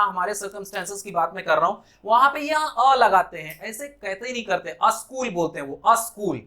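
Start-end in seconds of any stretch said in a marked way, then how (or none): 4.8-5.3 अ स्कूल with an extra "a" in front; incorrect pronunciation. Shown as a demonstration. Teacher quoting learners' mistake, अ स्कूल as "a-skool". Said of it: "incorrect pronunciation". 5.9-6.4 अ स्कूल with an added opening vowel; incorrect pronunciation. Shown as a demonstration. Teacher repeating learners' error, अ स्कूल as "a-skool". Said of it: "incorrect pronunciation".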